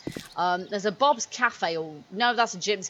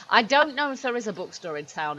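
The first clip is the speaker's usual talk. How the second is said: ordinary delivery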